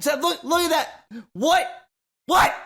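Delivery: high, loud alarmed shout; startled shout. Acted. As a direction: yell out in shock at the waves. Player shouting at the waves.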